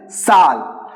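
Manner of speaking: "Sal" begun with a plain s; s sound. Shown as a demonstration. Teacher gives the correct form, "Sal" with an s. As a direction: say "Sal" with s for the German Z, falling tone. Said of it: "it is Sal".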